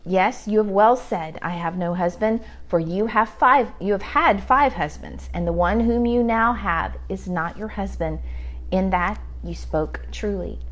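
scripture reading. Acted. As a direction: read the verse steadily, like from the pulpit. Reads scripture aloud.

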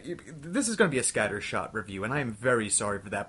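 usual speaking voice

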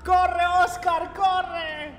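high, playfully urging call; hammed-up cheer to flee; mock urgent cry. Teacher urging Oscar to run from police, mock urgency.

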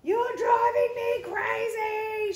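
shout. Acted. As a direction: yell it at your rhyming sister. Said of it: "shouted Sister".

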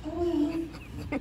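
high, soft dismissive oh well; careless shrug. Retold or imitated. Mom walking out on her daughter, high, soft and dismissive. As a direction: toss it off as you leave. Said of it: "walk out the house like".

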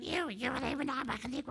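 high, fast creature voice yelling down from the roof; frantic high creature yell from up high; Salacious Crumb yelling. Acted. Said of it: "he's yelling down".

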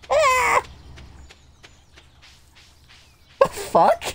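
loud high squeal; squeaky cartoon squeal. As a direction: yelp like a tiny cartoon fruit. Keeps squeaky voice.

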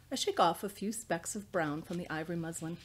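ordinary delivery

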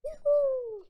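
loud high whoop of joy; cheer. Real, not acted. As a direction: whoop like you just won. Cheering at trick.

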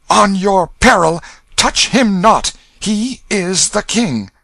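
very high, very loud shouted command; furious cry. A narrator's reading. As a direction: stamp and shout them off. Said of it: "stamped his foot and cried out".

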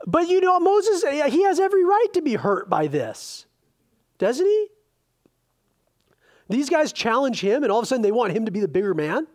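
high, loud impassioned preaching; preaching at full force. Acted. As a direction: drive the point home to the congregation.

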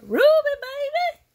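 high, loud sing-song call of a name; excited call. Real, not acted. Vlogger calling out to her cousin, excited. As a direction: call the maker's name out with delight.

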